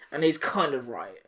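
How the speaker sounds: ordinary talk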